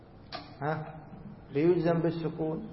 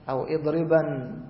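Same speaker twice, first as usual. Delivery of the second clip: ordinary talk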